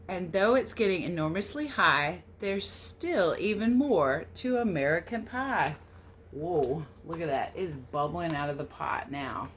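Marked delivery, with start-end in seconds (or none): 0.1-5.8 storybook read-aloud. A narrator's reading. Reading the American Pie book aloud to children, storybook lilt. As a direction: read the verse a little louder, with a gentle lilt. Reads the picture book text.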